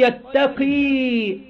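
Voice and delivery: يتقي drawn out very slowly, final ya kept long; Qunbul reading. Shown as a demonstration. Teacher shows students Qunbul's reading, slow يتقي. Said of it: "مش شكل قراءة حفص".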